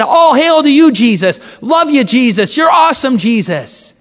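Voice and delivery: loud, high cheer of praise; crowd acclamation. Retold or imitated. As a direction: hail him from the middle of a cheering crowd. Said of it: "people screaming, "Hosanna to the King".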